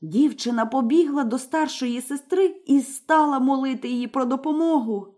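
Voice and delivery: ordinary delivery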